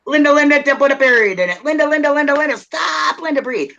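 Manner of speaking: mock-urgent plea to stop and breathe; brother interrupting. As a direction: imitate someone cutting off a nonstop talker. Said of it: "He reminds me to breathe".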